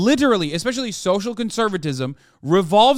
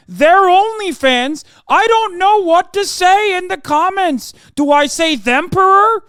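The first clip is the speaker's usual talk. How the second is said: much higher, louder mock voice on the verge of tears; hammed-up choked-up lecturer, pitched way up and loud; mock teary Peterson; 10 semitones higher, 7 dB louder than usual. Streamer mocking Jordan Peterson for chat, teary high loud parody. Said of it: "Always on the verge of tears, of course".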